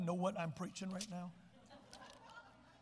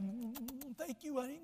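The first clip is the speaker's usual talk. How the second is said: soft, babyish mock Ahab; thumb-sucking Ahab; 4 semitones higher, 6 dB quieter than usual. Mocking Ahab thanking Jezebel, soft babyish coo. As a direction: coo it like a spoiled child. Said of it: "sucking his thumb".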